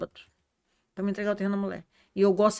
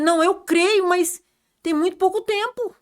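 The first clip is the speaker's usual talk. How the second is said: higher, louder retold objection; animated protest; 9 semitones higher, 7 dB louder than usual. Speaker protests to sister that time is short, animated protest. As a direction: push back insistently, voice raised.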